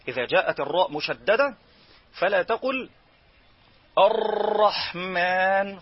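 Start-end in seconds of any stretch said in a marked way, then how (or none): 4.0-5.8 الرحمن with the r trilled too long, slowly; overrolled r. Shown as a demonstration. Teacher showing the wrong r in الرحمن. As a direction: roll the r of الرحمن too much. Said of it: "خطأ".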